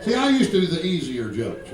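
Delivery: normal talking voice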